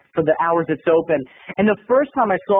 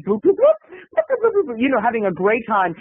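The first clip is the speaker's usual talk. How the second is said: very high little boops; imitated game beeps; 3 semitones higher than usual. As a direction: mimic arcade game bleeps.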